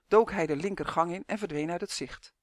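usual speaking voice

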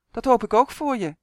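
boy calling back to his mother on a bad line; loud on the phone. A narrator's reading. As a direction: call it into the receiver. Son talks loud on phone.

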